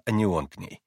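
plain reading voice

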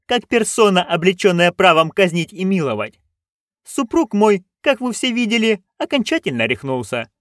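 high-pitched, haughty proclamation; imperious duchess, declaring. Acted. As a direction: announce it as a ruler with power to punish. (властная герцогиня, высоким голосом)

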